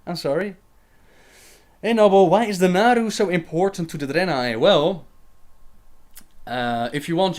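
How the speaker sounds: ordinary delivery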